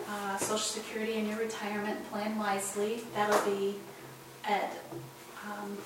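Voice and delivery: ordinary delivery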